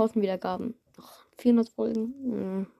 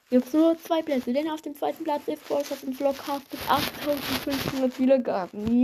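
hyped giveaway announcement; 5 semitones higher than usual. Real, not acted. Announcing second place.

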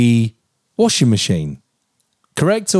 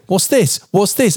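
mock wife; 6 semitones higher than usual. Husband mimics wife approaching.

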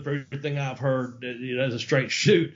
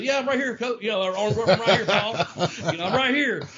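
cheeky reply; 6 semitones higher, 3 dB louder than usual. Retold or imitated. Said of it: "Brad popped up and said".

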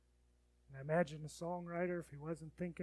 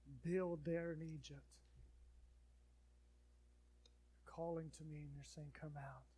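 praying; 4 dB louder than usual. Acted. Leads a prayer.

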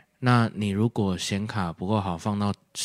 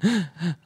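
a bright burst of real laughter up high; 9 semitones higher than usual. Real, not acted. Laughing at her own video title slip, high real laugh. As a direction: laugh it off, pitched up.

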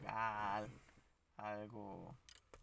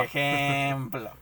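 "Ejemplo" stretched very slow and loud, falling; a slow, loud, teasing "Ejemplo"; drawn out; 9 dB louder, much slower than usual. Acted. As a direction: drag "Ejemplo" out syllable by syllable. Playful back-and-forth echoing "ejemplo.

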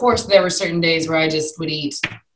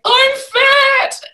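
squeaky high wail, much higher, nearly breaking; tearful wail; 5 dB louder than usual. Retold or imitated. Pregnant woman crying to herself at home, tearful high wail. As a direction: whimper it like you're crying at home. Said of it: "being in tears, being like".

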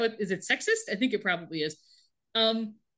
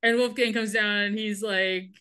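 ordinary delivery

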